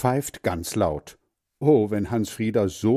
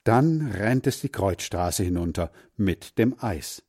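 ordinary delivery

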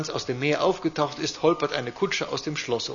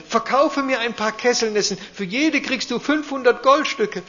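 high eager little duke voice; a small ruler in a high voice; little grand duke calling; 10 semitones higher, 6 dB louder than usual. Acted. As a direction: chime in high and insistent. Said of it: "der Großherzog".

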